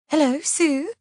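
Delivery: high, tremulous, frightened greeting; high-pitched tremulous questioning; feigned terror. A narrator's reading. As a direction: put on a scared, tremulous voice. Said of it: "putting on a terrified voice, she says tremulously".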